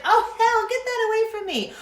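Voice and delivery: high, loud recoiling yelp; mock disgusted friend. Friend recoiling from the oil, high mock disgust. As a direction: pull back in mock horror.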